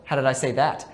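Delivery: a hint of a scolding mother; lightly voiced mom asking how it was said; imitating mom. Speaker imitating his mother correcting his tone, lightly. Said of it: "My mom used to say it to me".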